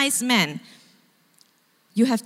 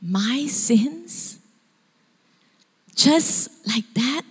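mild tearful disbelief; 3 semitones higher, much slower than usual. Retold or imitated. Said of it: "This guy starts to break down".

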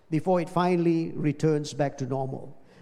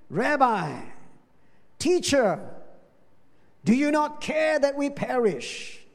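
high, urgent plea of frightened disciples; high panicked cry to the sleeping teacher; 7 semitones higher, slower than usual. Retold or imitated. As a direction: cry out in fear of drowning.

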